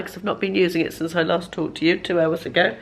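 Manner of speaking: usual speaking voice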